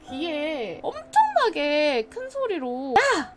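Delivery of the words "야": higher sharp yell of "야"; retold shout. Voice yelling into her ear, mock yell. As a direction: startle the sleeper with one cry. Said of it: "이렇게 소리를 지르는 거야".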